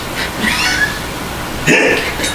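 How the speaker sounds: very high, airy squeal of laughter; a wheezing squeak of a laugh; high breathless squeal. Real, not acted.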